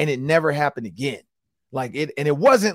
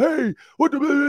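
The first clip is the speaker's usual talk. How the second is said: high-pitched mock yell at the players; hammed-up coach scream, pitched way up; imitating the coach screaming at the players; 12 semitones higher than usual. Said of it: "screamed and yelled".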